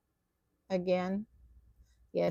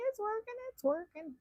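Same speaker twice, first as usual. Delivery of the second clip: much higher, delighted squeal; excited relief; 6 dB quieter than usual. Real, not acted. Tatting finally works.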